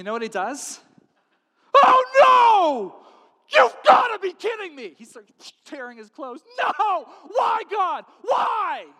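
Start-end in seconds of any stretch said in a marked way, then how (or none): 1.8-4.9 high, full-force wail of dismay; anguished shout. Acted. King reading the impossible letter, anguished shouting. 6.6-8.9 high, loud cries of despair; anguished wail. Acted. King tearing his clothes, wailing in despair.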